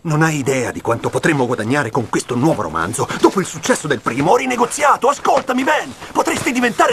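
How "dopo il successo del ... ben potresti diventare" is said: high-pitched, insistent pleading; rising, urgent sales pressure in an argument; heated urging. Acted. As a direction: push hard to be heard over his objections. Editor urging writer in argument.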